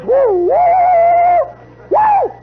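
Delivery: very high, loud, sustained whoops; imitated whistle signal. Drum major points at the stray player, piercing whistle whoops. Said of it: "He goes".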